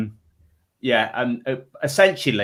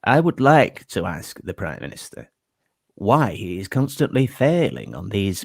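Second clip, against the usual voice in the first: lightly voiced Yorkshire politician; a hint of stern debate delivery; William Hague impression; 6 semitones lower than usual. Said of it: "sounded a little bit like this in his delivery".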